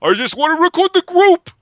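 high, loud, frantic shout; Keitel impression. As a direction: yell it like a fed-up manager. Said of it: "throughout the film going".

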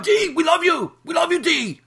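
imitating fans cheering for D; mock adoring fans. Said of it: "They're like".

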